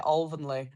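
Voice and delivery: "Alvanley" said OL-vun-lee, as members do; local Alvanley pronunciation. Shown as a demonstration. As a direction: demonstrate "Alvanley" with a rounded OL start. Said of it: "how do they say it".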